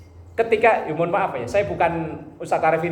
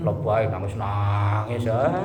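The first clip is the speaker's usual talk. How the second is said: slow, lower, scornful lebay; mocking; 9 semitones lower, slower than usual. Speaker scoffing at a prayer leader crying too much. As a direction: drag out lebay in mockery.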